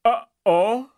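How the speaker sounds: high, loud uh-oh; a king caught out; shaken Tsar. Acted. Tsar realising the deal, high alarm. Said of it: "visibly shaken".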